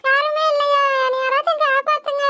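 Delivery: put-on high child voice, desperate calls for rescue; a small girl screaming for her mother from the water; tearful high-pitched pleading; little girl crying for help.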